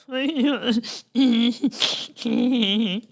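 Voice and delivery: very high cartoon giggle. Bob giggles at the host, high giggle. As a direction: giggle like a tiny sidekick.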